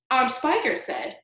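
plain reading voice

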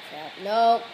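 ordinary delivery

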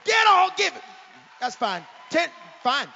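high, loud counting shout; preacher yelling the countdown. Real, not acted. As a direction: rouse the crowd to praise.

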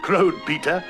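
plain reading voice

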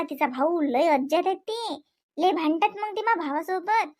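ordinary talk, nothing marked

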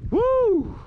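ordinary talk, nothing marked

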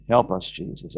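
usual speaking voice

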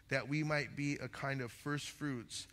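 ordinary delivery

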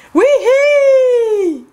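high, loud sledding whoop; gleeful squeal. Acted. Kids sledding down the hill, squealing with joy.

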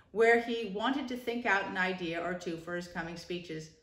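ordinary delivery

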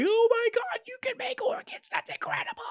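very high, squealing cartoon voice; squeaky excited Chopper. Chopper thrilled by lab-grown organs, squeaky high excited voice. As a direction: gush about the organs in a tiny high squeak. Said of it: "He's like".

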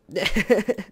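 short chuckling burst; self-deprecating laugh. Real, not acted. Player laughing that nobody likes that, self-deprecating laugh. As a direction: laugh off your own joke. Laughs after own sarcasm.

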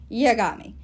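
put-on loud croaky old dwarf; croaking dwarf voice. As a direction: croak it out menacingly. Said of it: "the dwarf croaked".

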